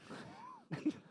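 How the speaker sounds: a burst of high squealing laughter. Real, not acted. Friend laughing at the long pretend kiss, high squealing laugh.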